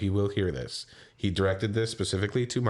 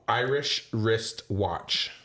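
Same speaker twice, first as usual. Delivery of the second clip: slowly spelled out; slow demo; much slower than usual. Shown as a demonstration. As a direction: say it slowly as a model. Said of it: "Try it".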